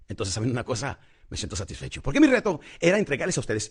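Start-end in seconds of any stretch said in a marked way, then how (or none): 2.0-3.7 higher, louder speaker's build-up; slightly raised voice. Shown as a demonstration. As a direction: lift the voice to drive the challenge home. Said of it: "la modulación de la voz un poquito más alta".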